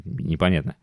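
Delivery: normal talking voice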